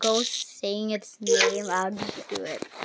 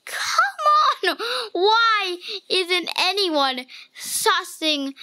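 frustrated shout; 7 semitones higher, 4 dB louder, much slower than usual. Real, not acted. Frustrated outburst in game.

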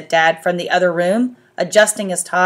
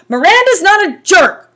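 put-on much higher, louder child voice; little brother chiming in; 12 semitones higher, 8 dB louder than usual. A narrator's reading. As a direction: pipe up fast like a small boy. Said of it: "Augie quickly chimed in".

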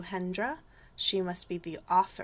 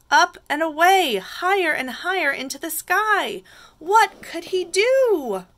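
dramatic read-aloud building upward; picture-book narration; 4 semitones higher, 11 dB louder than usual. A narrator's reading. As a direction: raise the suspense for young listeners.

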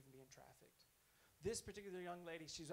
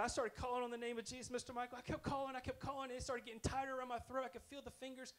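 frightened retelling; 7 semitones higher, 6 dB louder, faster than usual. Retold or imitated. Girl retells demon attack.

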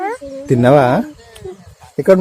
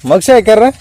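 raised, higher warning call; 5 semitones higher, 4 dB louder than usual. Real, not acted. Calling child away from traffic. (హెచ్చరిస్తూ గట్టిగా పిలుపు)

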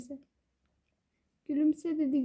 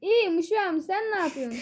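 put-on Rize accent; 5 semitones higher than usual. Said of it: "İnsan gibi konuş".

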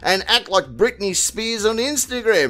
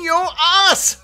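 a sudden high shouted punchline; mock yell; 10 semitones higher than usual. Comedian taunting the arrested man, shouted high. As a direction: yelp the last words of the joke at the viewer. Mocking punchline about jail.

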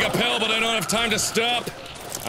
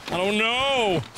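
raised, drawn-out repeat; exasperated exclamation; 3 semitones higher, slower than usual. Real, not acted. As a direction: throw it out in mild exasperation. Exclaimed repeat of same words.

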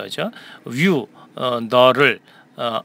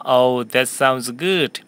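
slow, clear sentence for repeating; teacher modelling a question slowly for students; slow model reading; much slower than usual. Shown as a demonstration. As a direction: read it slowly for the class to echo. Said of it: "한 번 더요".

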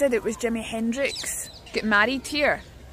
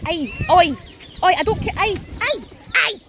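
scolding birds; 6 semitones higher, 4 dB louder than usual. Real, not acted.

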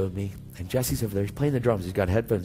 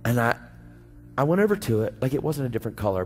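ordinary talk

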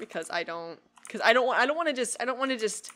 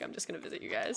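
unvoiced secretive whisper; 9 dB quieter, faster than usual. Shown as a demonstration. Repeats the line in a whisper.

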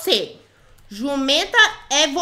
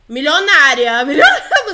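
laughing boast; 8 dB louder than usual. Real, not acted. Player laughs at being called a millionaire, laughing loudly. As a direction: crack up on the word. Ri da própria mentira de riqueza.

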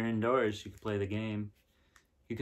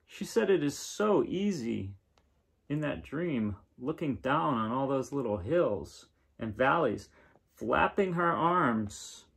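gentle storybook reading, a bit brighter; reading to children; 2 semitones higher, 3 dB louder than usual. Acted. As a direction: read the picture book aloud to kids. Reading picture book aloud.

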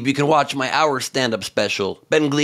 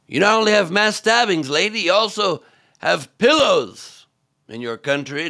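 louder, higher mock heckle; mock heckler jab; 7 semitones higher, 3 dB louder than usual. Comedian imitating the British heckler, mock loud jeer. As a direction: toss off a jeering retort, lady.

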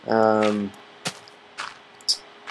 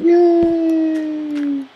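a tiny high-pitched yay, like a delighted cartoon character; squeaky cheer. The avatar finally allowed to speak, squealing yay. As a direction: squeal it as the happy little avatar.

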